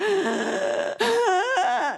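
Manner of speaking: high, loud sobbing gasp; imitated ugly crying intake. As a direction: gasp in a sob. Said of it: "like an ugly cry".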